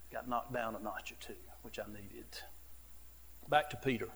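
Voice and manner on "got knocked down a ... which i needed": soft humbled whisper; whispered aside. Real, not acted. Pastor confessing he needed humbling, whispered. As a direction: breathe it out quietly.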